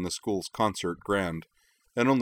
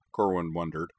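usual speaking voice

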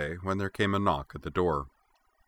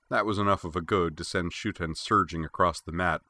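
ordinary talk, nothing marked

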